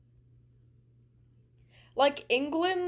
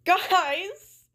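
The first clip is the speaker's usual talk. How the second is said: exasperated call; 7 semitones higher, 6 dB louder than usual. Real, not acted. Exasperated appeal to viewers.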